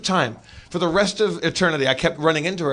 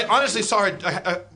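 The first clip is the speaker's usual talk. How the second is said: ordinary talk, nothing marked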